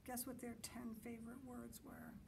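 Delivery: ordinary delivery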